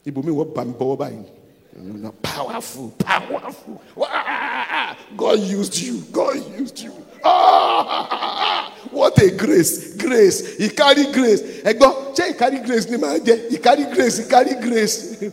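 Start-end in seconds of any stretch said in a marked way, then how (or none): 2.2-8.7 raised, excited voice mimicking admirers; imitated gushing praise. 8.9-15.2 loud, high mock admiration; imitated awed acclaim. Imitating admirers praising his grace.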